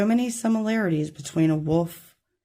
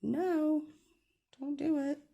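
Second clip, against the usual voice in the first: much higher, tiny kitty voice; pet cat voice; 10 semitones higher, 7 dB quieter, slower than usual. Pretend. Reader voicing her cat's warning, tiny high voice. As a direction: squeak it out as the little cat. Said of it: "Baby kitty said".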